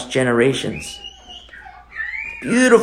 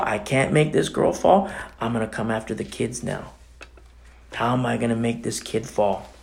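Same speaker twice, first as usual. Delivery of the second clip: lightly voiced scheming Satan, hushed; understated plotting to oneself, trailing lower; 4 semitones lower, 5 dB quieter than usual. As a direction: think the scheme through softly, as the tempter. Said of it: "Satan is at work plotting".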